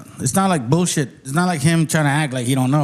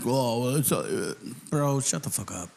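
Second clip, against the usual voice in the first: low, soft, deflated brush-off; mock dismissive reply; 5 semitones lower, 8 dB quieter than usual. Brushing off the sisters' compliment, mock dismissive. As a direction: drop low and quiet, shrugging it off.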